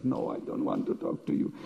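whispered inner voice; very soft acted aside to himself; private thought. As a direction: whisper it as an unspoken refusal. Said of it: "In his mind he's thinking".

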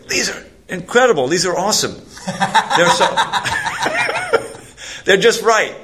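high-pitched raving, breaking into laughter; amazed enthusiasm. Retold or imitated. Security guard admiring the book's pictures, amused mock amazement.

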